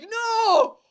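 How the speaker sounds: high, loud retold cry of alarm; horrified shout. Watching the girl jump from the window, horrified. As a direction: yell it in hammed-up horror.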